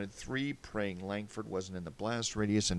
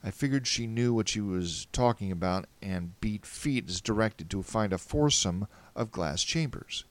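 plain reading voice

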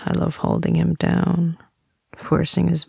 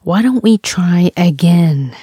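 ordinary talk

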